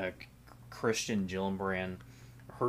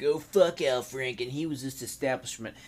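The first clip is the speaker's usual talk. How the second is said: mocking whiny critic; 5 semitones higher than usual. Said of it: "you fucking dipshits like".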